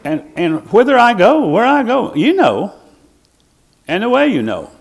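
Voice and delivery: high, loud preacher's cry; impassioned preaching. Acted. Preacher crying out scripture to the congregation, impassioned and high.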